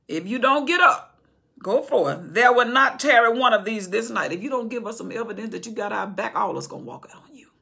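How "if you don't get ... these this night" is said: loud, urgent ultimatum; loud warning. Acted. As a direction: press the king with a threat. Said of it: "Joab said".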